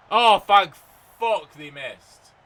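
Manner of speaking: loud high cry easing into slow relief; relieved outburst. Real, not acted. Gamer as the rivals miss, loud relieved outburst. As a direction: let the relief burst out.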